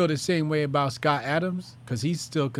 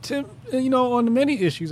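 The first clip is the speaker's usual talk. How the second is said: cutting in loudly; 9 semitones higher, 5 dB louder than usual. Real, not acted. Host talking over a ranting caller, loud and high. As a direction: jump in higher and louder to cut him off.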